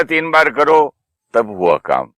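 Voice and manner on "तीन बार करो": raised, high and loud order; firm loud instruction to do it three times; loud command. Retold or imitated. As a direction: say it forcefully, like losing patience. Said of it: "जब मैंने जोर से बोला ना".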